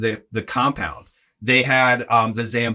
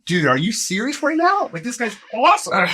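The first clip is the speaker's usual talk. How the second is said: much higher, giddy with delight; thrilled disbelief; 11 semitones higher than usual. Retold or imitated. Greg gushing about the helpful Zamboni guy, high and thrilled. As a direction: gush like you can't believe your luck.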